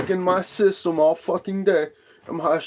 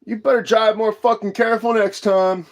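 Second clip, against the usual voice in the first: higher, stern telling-off; scolding warning; 7 semitones higher than usual. Retold or imitated. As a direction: lecture the careless driver. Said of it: "I shook my head like this".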